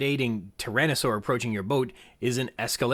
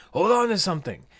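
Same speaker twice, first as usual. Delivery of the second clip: raised, louder warning; mock urgent call; 7 semitones higher, 5 dB louder than usual. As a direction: call it out high and firm. Quoting Grant's exclamation.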